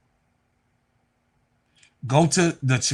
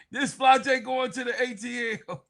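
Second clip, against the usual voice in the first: laughing; 8 semitones higher than usual. Real, not acted. Laughs at a player's rumour.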